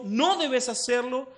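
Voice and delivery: "No" hit high and loud; emphatic no. Retold or imitated. Father warning daughter, emphatic "No". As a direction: stamp the word "No" as a warning. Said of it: "uno toma énfasis en eso".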